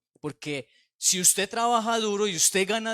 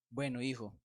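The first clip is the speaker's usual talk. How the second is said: tender father; 5 semitones lower, 10 dB quieter than usual. Acted. Father answers the Son softly.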